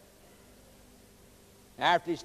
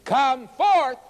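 high, loud commanding shout; retold shout; 7 semitones higher, 6 dB louder than usual. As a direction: call out across the hills. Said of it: "he would have shouted out".

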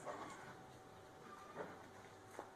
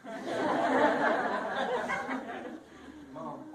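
hearty laugh; 9 semitones higher than usual. Real, not acted. Preacher laughs.

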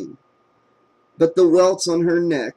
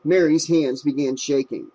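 ordinary delivery, nothing marked

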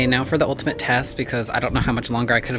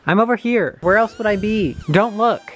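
higher raised voice calling across; 6 semitones higher, 4 dB louder than usual. Real, not acted. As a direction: call out across the yard. Calling to mother from outside.

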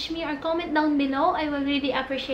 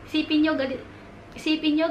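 ordinary delivery

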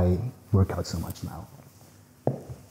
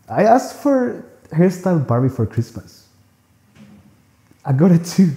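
high-pitched, tickled delight; giddy confession; 8 semitones higher than usual. Acted. Man confessing he asked for a Barbie, giddy. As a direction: confess it high, with a laugh going in.

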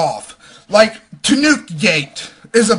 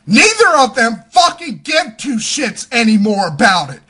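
ordinary talk

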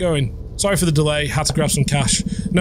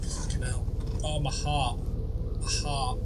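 breathless whispered curse, much softer; shaken whisper; 4 semitones lower, 11 dB quieter than usual. Real, not acted. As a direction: whisper it after a fright. Jump scare in horror game.

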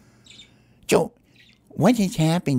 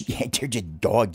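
normal talking voice

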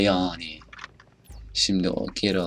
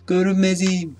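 gleeful high voice; 10 semitones higher than usual. Pretend. Player boasting of being invisible, high playful crow. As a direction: crow it in a raised pitch.

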